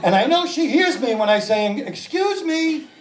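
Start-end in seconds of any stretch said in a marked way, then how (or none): 1.8-2.9 high, drawn-out polite call; polite interruption. Acted. Shopper asking old lady to move, politely high. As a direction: try gingerly to get someone's attention.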